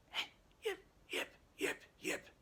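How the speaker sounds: voiceless, muted little barks; quiet dog yips. Acted. Imitating lab dogs with cut larynxes, quiet yips. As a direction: imitate a dog yipping without a voice. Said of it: "why are they barking so quiet".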